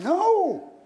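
high, huffy denial; offended. Acted. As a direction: protest too much, playing offended. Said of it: "when we get offended".

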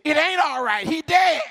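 hammed-up grieving outburst; mock distraught protest. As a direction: pitch climbing to a high cry. Mourner snaps back at aunt.

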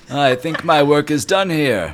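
high, loud and slow genie voice. Acted. The genie declares his job finished, high, loud and slow. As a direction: proclaim it grandly and slowly.

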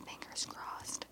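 quick hushed whisper, fading away; whispered hope. Real, not acted. Telling listeners the hacker failed, a hushed hopeful whisper. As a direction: whisper it like a superstition.

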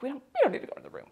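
high, rushed backpedalling; hurriedly wriggle out of it, half in jest; retold nervous reluctance. Retold reluctance to enter the rooms.

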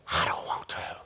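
hushed, nearly voiceless reluctance; acted hushed refusal; reluctant plea. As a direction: drop to a low, breath-only murmur. Acts out Jesus's reluctant plea.

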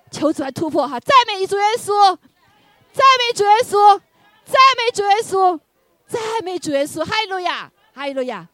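high, loud chanted praise to Jesus; exultant worship shouts. Real, not acted. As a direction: keep the crowd shouting.

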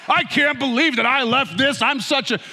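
high, loud laughing self-reproach; laughing at his own drama; mock self-dramatic. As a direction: ham up scolding himself. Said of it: "being dramatic about it".

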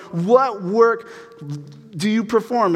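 mock the crowd's nerve; hammed-up baffled crowd; mocking disbelief. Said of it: "I don't know why they're going".

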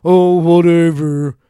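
hammed-up whatever; mock dismissive. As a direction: toss it off like you can't be bothered. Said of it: "people call me stupid or".

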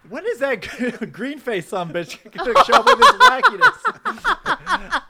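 loud mock outrage through laughter; indignant kid. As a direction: shout it like a cheated boy. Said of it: "before I was like".

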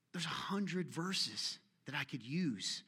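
slow breathy whisper; whispered aside. Real, not acted. Pastor muttering about too many verses, whispered. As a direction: let it slip out under the breath.

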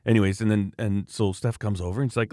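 ordinary delivery

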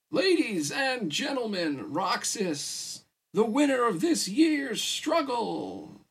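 high, drawn-out ring announcer voice; slow, booming showman's build with stretched words; emcee announcing. A narrator's reading. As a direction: announce the winner to a whole arena. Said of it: "Wallace announced".

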